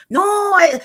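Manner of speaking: high, stretched mimicking "no"; mock ignorant. She mimics the woman answering Dre, mock ignorant. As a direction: imitate her with a high, loud wail. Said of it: "She sounded very in-, ignorant".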